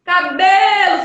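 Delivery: cabelo called loudly, lingering on BE; stretched stressed syllable. Shown as a demonstration. Teacher showing where the voice lingers, cabelo with long BE. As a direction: hold the be of cabelo long and loud. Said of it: "Aonde você ficar mais tempo ali".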